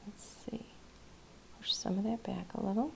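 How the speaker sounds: whispered under the breath while painting; focused whisper. Real, not acted. Painter absorbed in her brushwork, whispering to herself. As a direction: murmur it to yourself, barely voiced. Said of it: "I'm getting really quiet because I'm focused".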